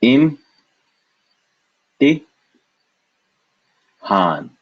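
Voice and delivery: im, ti, han said apart, im high; syllable by syllable. Shown as a demonstration. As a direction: break the word into syllables.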